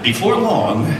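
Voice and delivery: usual speaking voice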